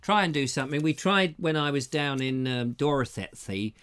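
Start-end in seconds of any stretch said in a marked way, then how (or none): 2.7-3.7 low West Country accent; Somerset accent. Shown as a demonstration. Comic trying Dorset talk on his trip, Somerset accent. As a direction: slip into a low Somerset yokel burr. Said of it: "They don't really talk like that 'cause that's more like Somerset".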